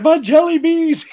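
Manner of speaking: put-on high, loud childish wail over lost candy; whiny movie line. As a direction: cry it out like a spoiled addict. Quoting a movie character's whine.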